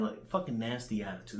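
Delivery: normal talking voice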